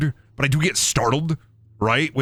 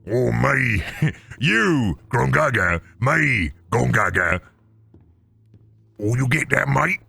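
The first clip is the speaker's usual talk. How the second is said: orc; slower than usual. Acted. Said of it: "Probably orcs".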